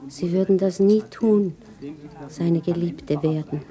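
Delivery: ordinary talk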